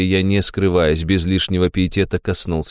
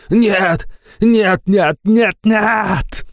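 shouting no; much slower than usual. Acted. Old man thrashing in despair.

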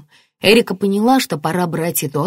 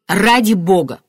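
louder, exasperated snap; flaring up; 3 semitones higher, 5 dB louder than usual. A narrator's reading. Martin to his son Toby, flaring up. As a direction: lose patience for a moment. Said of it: "вспылил Мартин".

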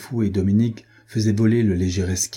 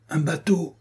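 crying out; 8 semitones higher than usual. A narrator's reading. Man spotting a boat for the fugitives, crying out. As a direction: call it out high, without real volume. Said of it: "cria-t-il".

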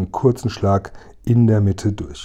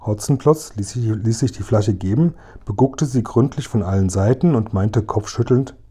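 usual speaking voice

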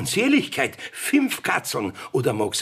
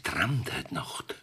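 slow, much softer whisper; 9 semitones lower, 9 dB quieter, slower than usual. Acted. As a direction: confess the dream under your breath. Richter gesteht sich seinen Traum. (langsam, sehr leise geflüstert)

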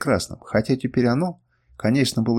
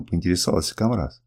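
ordinary delivery

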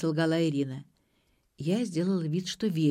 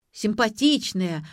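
loud, higher, slow exclamation; exclaiming; 5 semitones higher, 6 dB louder, slower than usual. A narrator's reading. Father suddenly remembering the woman, exclaiming. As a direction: exclaim it as it comes back. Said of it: "воскликнул тот".

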